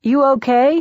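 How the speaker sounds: loud, high concerned check; calling through door. A narrator's reading. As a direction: raise your voice to carry through a door. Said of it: "he calls through the door".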